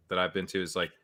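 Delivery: usual speaking voice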